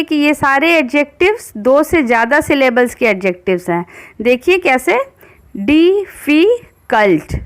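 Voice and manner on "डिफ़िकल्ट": डिफ़िकल्ट slowly split into di-fi-kalt; डिफ़िकल्ट drawn out into its three syllables; syllable demo. Shown as a demonstration. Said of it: "इसमें कितने syllables हैं".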